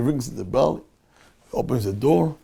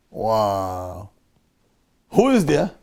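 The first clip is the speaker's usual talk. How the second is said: ordinary talk, nothing marked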